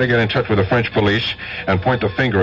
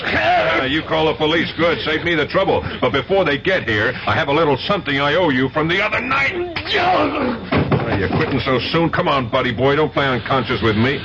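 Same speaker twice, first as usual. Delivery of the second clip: taunting; 8 semitones higher than usual. Acted. Agent taunting the beaten eavesdropper.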